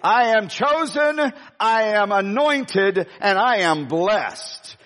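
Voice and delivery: slow, deliberate line for the crowd to repeat; slow declaration. Acted. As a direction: lay out each phrase for them to echo.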